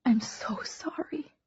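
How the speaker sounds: slow breathy whisper of apology; a broken whisper into his lap; tearful whisper. Acted. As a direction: barely voice it, slow, near tears. Hazel crying into his lap.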